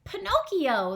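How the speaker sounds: high eager answer; puppet voice. Acted. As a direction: call out his own name. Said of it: "the puppet replied".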